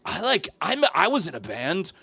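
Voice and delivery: high-pitched, delighted mock discovery; excited bonding dad. Movie dad bonding with the boyfriend, mock excited.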